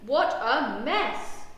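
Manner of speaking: loud, slow, exasperated exclamation; groan. A narrator's reading. Dad surveys the porridge on the floor, groaning. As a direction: stretch out each word in dismay. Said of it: "groans Dad".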